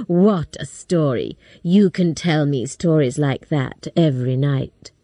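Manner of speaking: a man sure he has won; Buff's voice. A narrator's reading. Buff speaks.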